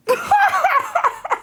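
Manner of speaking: squealing laugh at herself; real giggling fit at her own joke; high burst of laughter. Real, not acted. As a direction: crack up high and bright.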